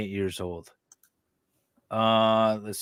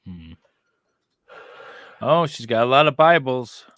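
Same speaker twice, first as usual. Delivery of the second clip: usual speaking voice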